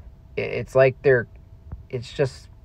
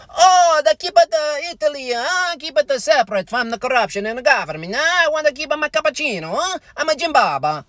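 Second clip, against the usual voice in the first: much higher, cartoonish Italian accent; mock Italian accent. Mocking Italian-heritage pride, in a high, cartoonish Italian accent. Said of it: "And I'm also not like".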